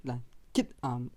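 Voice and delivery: ordinary delivery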